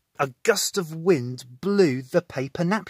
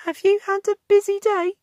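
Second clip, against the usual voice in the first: Wendy's voice; 7 dB louder than usual. Acted. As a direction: lift it up into Wendy. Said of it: "Wendy asked".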